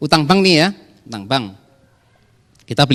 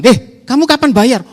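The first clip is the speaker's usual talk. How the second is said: a touch of an angry supplier, higher and louder; mock angry demand; 10 semitones higher, 7 dB louder than usual. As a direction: play the impatient supplier demanding payment. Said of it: "nggak dibayar-bayar kan ngamuk kan".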